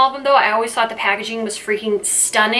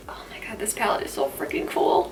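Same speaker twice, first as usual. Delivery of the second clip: hushed, breathy whisper of awe; awed whisper; 8 dB quieter than usual. Real, not acted. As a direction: whisper it like a treasure reveal. Gushing over palette, hushed.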